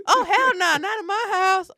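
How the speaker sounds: loud, high mock refusal; parent's overreaction. Parent shutting down a child asking about weed, mock outrage. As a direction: lay down the law, hammed up. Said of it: "after that reaction".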